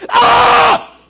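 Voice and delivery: very high, very loud comic shriek; comic screech of a nagged husband; inner scream. Pretend. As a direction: let out the man's silent scream. Man's inner scream acted.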